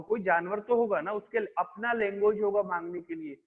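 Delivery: ordinary talk, nothing marked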